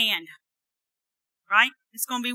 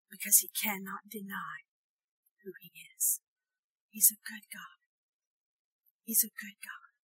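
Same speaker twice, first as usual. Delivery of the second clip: hushed preaching; 6 semitones lower, 19 dB quieter than usual. Acted. Soft intimate preaching.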